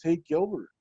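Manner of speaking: high-pitched imitation of the cook; kindly cook offering leftovers, voiced high; head cook offering. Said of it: "she goes".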